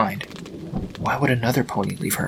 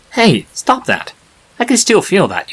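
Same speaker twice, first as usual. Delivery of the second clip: annoyed statue voice, raised and loud; annoyed protest; 8 semitones higher, 5 dB louder than usual. Acted. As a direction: tell the foal off as she grabs your face. Foal grabs statue's face.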